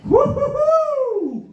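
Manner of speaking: very high slow hooting whoop; hammed-up high whoop; mock hoot. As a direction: hoot like an owl at the part. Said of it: "I'm like".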